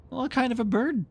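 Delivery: put-on curious boy. Boys question Nibs.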